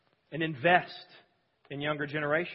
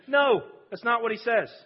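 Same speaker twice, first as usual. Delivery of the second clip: higher, louder protest; forceful pulpit denial; emphatic rebuttal; 6 semitones higher, 5 dB louder than usual. Real, not acted. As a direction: shut the claim down firmly. Preacher rebuts talk-show accusation.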